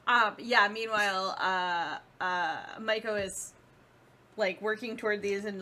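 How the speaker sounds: ordinary talk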